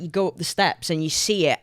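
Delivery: ordinary delivery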